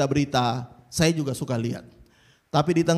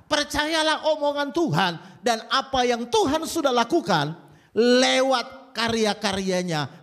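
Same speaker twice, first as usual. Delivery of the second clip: ordinary talk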